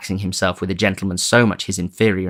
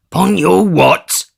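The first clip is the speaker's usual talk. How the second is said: higher, louder, slow scornful retort; disgusted growl; 10 semitones higher, 7 dB louder, slower than usual. Acted. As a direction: spit it back in disgust. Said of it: "growled Sikes with excessive disgust".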